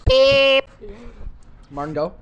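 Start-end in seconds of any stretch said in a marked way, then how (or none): 0.1-0.7 loud, high censor beep; bleeped out. Shown as a demonstration. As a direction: imitate a TV bleep, sharp and loud. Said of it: "I'm gonna redo mine. It's gonna be like".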